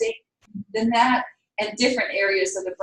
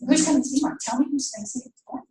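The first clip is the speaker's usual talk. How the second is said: fast, higher mock-mother prodding; rapid-fire mom; 4 semitones higher, much faster than usual. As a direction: rush the question out, pushing for an answer. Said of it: "She's talking really fast".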